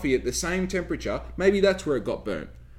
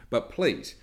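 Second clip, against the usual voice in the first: ordinary talk, nothing marked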